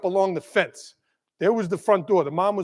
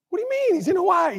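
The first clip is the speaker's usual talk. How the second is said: much higher, shocked questioning; retold disbelief; 11 semitones higher, faster than usual. Teacher hearing students say his pupil died, retold disbelief. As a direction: recreate stunned disbelief in a high pitch.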